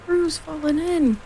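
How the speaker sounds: high, drawn-out cry of alarm. A narrator's reading. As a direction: cry the news out slowly, pitched high. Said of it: "cried Rabbit".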